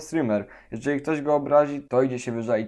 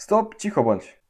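urgent; 8 semitones higher than usual. Acted. Urgent hushing after the shout.

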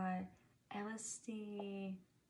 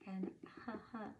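librarian shushing whisper; 4 dB quieter, much faster than usual. Real, not acted. Reading book text softly.

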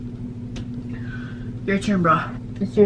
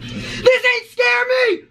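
shouting; 11 semitones higher, much slower than usual. Real, not acted. Psyching up before gulp.

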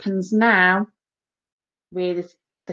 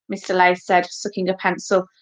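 usual speaking voice

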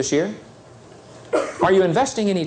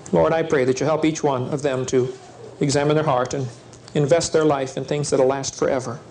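closing prayer; 4 semitones lower than usual. Acted.

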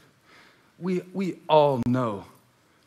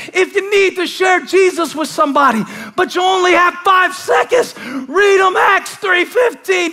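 loud, much higher, driving preacher's cry; shouted preaching; 8 dB louder than usual. Acted. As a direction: whoop it to the rafters. Preaching at full force.

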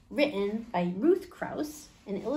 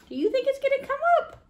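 put-on much higher, fast, bright question to kids; eager question; 5 dB louder, much faster than usual. Storyteller asking the children, high and fast. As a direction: pop up high and quick to ask.

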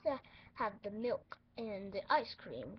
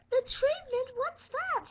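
mock alarm; 12 semitones higher, 10 dB louder than usual. Patient alarmed by treatment.

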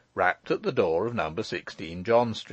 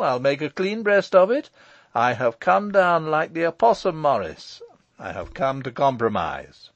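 loud, emphatic declaration; breezy Michael; 4 semitones higher, 6 dB louder than usual. Acted. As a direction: announce it with mock-solemn confidence.

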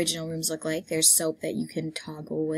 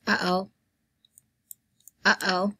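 normal talking voice

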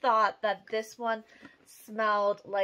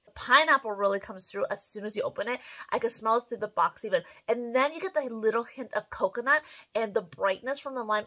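ordinary delivery

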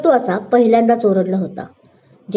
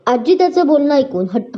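ordinary delivery, nothing marked

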